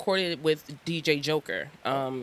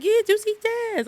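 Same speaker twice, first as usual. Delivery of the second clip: put-on drunk friend voice; 4 dB louder, faster than usual. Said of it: "He's like".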